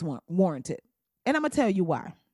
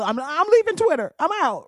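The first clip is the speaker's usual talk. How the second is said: mock announcement; 6 semitones higher, 4 dB louder than usual. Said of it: "So she shut it all".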